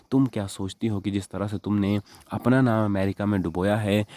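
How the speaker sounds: ordinary delivery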